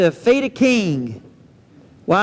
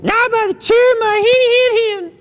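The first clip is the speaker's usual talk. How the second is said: much higher squeaky pig voice; squeaky little pig; 13 semitones higher, faster than usual. Acted. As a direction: pipe it up high like a tiny pig. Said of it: "Pidlig says".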